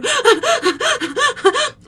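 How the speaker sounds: high, loud gasping sobs; acted child losing it; hysterical mock sobbing. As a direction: wail in short panicked bursts. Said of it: "I'm losing my mind".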